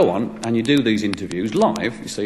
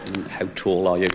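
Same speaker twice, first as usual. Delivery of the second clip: mock deep questioning; 5 semitones lower, 5 dB quieter than usual. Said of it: "he goes into this deep, involved".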